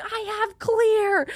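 high, slow, drawn-out protest; indignant retort. Retold or imitated. Traveler replaying her retort to the lady, indignant. Acting out screaming match.

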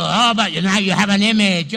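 loud, scolding accusation; accusing critic. Retold or imitated. Critic accusing the pastor of idolatry, loud scolding. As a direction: jab the accusation at him.

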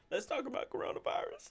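high, airy strained voice. Real, not acted. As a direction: squeeze it out after choking. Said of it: "excuse me".

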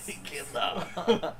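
high, put-on German voice; movie villain German accent. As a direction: echo it in a thick German accent. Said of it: "you're trying to sound like Nazis".

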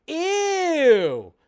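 high, loud sneering ew; mock disgust. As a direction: mimic a snobby actor turning their nose up. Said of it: "just goes like".

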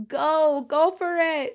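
high, loud cheer of support; cheering you on. Acted. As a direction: cheer like a crowd of ancestors. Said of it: "all of the realms are just cheering you on".

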